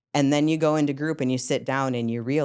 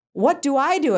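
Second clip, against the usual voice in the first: high, loud panicked plea; scared intern calling to the psychologists; retold panic; 13 semitones higher, 7 dB louder than usual. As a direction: beg for help in alarm. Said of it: "And I'm like".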